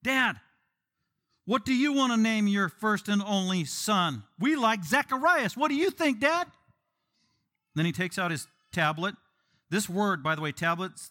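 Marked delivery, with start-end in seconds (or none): 0.0-6.5 high, loud, slow coaxing; relatives asking Dad. Acted. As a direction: call it out slowly as if Dad can't follow. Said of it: "So they asked him".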